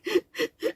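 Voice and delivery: a quick, high, soft giggle; a breathy laugh slipped in; high little laugh. Acted. Laugh alone between lines.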